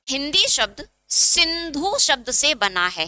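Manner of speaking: slow, measured note dictation; teacher dictating word by word, unhurried. Shown as a demonstration. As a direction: draw the words out for students writing them down. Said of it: "next note point लिखिए".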